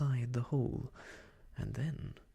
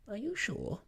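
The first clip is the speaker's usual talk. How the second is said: higher, startled question; surprised; 9 semitones higher than usual. Acted. Rabbit doubting Pooh, surprised higher question. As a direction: Rabbit caught out, asks in surprise. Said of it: "still more surprised".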